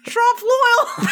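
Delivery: high-pitched attempt at Trump loyal, near laughter; struggling pronunciation. Shown as a demonstration. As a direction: Trump loyal, rhyming the end with royal. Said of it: "It's like L'Oreal".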